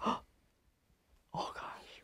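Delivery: whispered "oh gosh"; a horrified breath, unvoiced; shocked whisper. Shocked by internment story.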